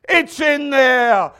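slow, stretched high bellow; bellowed answer. Acted. God answering needy Christians, slow hammed-up bellow. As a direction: roar it, drawing out every word.

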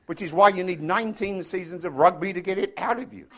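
ordinary talk, nothing marked

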